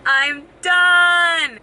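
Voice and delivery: loud joyful cheer; excited shout. Real, not acted. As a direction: shout it out, finally free. Finished the last blood test.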